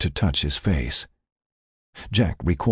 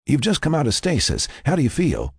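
higher-pitched caretaker voice; Frank; 9 semitones higher than usual. Acted. Frank checks on the revived patient, higher voice. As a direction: inform her, then check on her.

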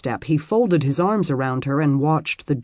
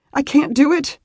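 sudden high, louder outburst; blurted out; 11 semitones higher, 5 dB louder than usual. Acted. Beth confessing to the stranger she cannot leave, blurting. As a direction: let it burst out before she can stop. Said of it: "she blurted".

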